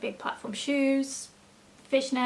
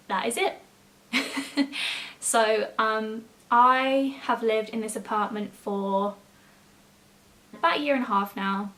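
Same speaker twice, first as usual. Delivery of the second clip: usual speaking voice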